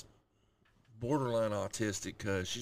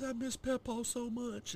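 put-on high, slow childlike sad voice; a small girl missing her grandpa; little girl sad. Daughter voiced, crying.

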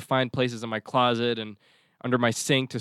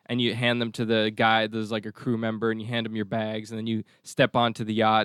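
ordinary talk, nothing marked

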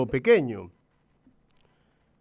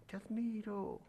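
much softer, distant echo; faint echo; 7 semitones higher, 15 dB quieter than usual. A narrator's reading. The mountain answers the father, faint echo. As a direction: let it drift back from afar. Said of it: "del otro lado le contestan".

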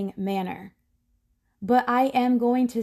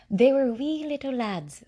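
a touch of playful Irish lilt, higher; hint of an Irish accent, pitched up; light Irish accent; 4 semitones higher than usual. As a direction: slip briefly into an Irish accent. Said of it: "I said an Irish accent like that".

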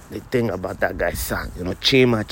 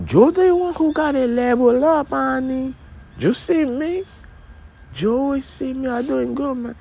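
high put-on voice; 3 dB louder than usual. High-pitched character boasting to partner.

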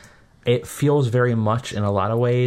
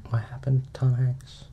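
whispering; 4 semitones higher, 4 dB quieter than usual. Real, not acted. Hushed gossip reply.

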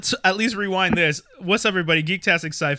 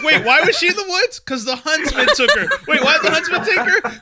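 laughing; 10 semitones higher, 4 dB louder than usual. Retold or imitated. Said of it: "He's like".

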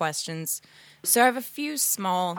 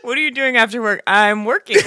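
mocking imitation; 4 semitones higher, 9 dB louder than usual. Mocking the girl from call.

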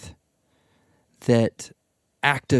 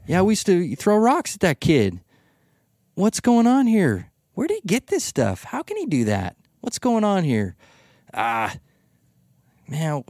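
scoffing townsfolk; 8 semitones higher than usual. Retold or imitated. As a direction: sneer at the local boy made good. Hometown crowd scoffing at Jesus.

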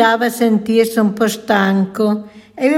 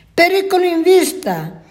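much higher, urgent warning cry; alarmed shout; 9 semitones higher than usual. A narrator's reading. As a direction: yell the alarm while running off. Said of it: "gridò fuggendo la talpa".

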